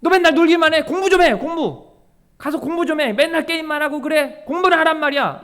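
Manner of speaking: nagging parent. Acted. Parent scolding a child who only games, loud nagging.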